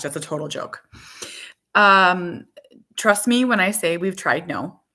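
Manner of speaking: ordinary delivery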